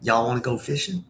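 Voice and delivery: Southern Indiana country twang; Southern accent. Shown as a demonstration. Demonstrating her hometown Southern accent.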